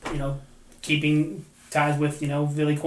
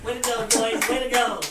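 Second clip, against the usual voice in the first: higher, louder cheering; mock Kessel sign-off; 8 semitones higher, 6 dB louder than usual. Host ends the episode in the player's voice, high and loud. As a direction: sign off the show in the joke voice.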